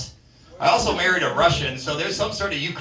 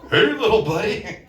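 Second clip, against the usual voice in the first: higher, put-on voice hailing a bear; put-on greeting; 5 semitones higher, 3 dB louder than usual. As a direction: call out to a little critter, higher. Acts out encounter with Meth Bear.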